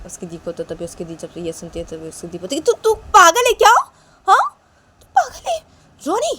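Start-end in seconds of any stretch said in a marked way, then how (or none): 2.7-6.3 much higher, louder shrieking sister voice; big sister yelling at her brother that he's crazy; scolding sister. Acted. Sister scolding Ronny, high voice.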